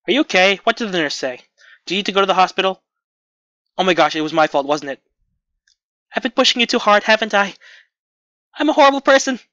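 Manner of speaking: put-on mildly agitated worried girl; agitated torrent. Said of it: "Those words pour forth like a torrent".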